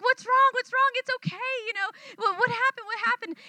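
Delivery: put-on high, gentle coaxing to a scared little girl; soothing a child. Comforting a frightened child.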